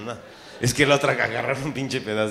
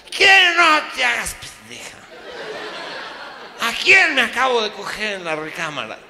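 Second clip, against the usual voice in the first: high, loud furious accusation; husband yelling at his wife; hammed-up rage; angry outburst; 8 semitones higher, 5 dB louder than usual. Husband confronts wife angrily.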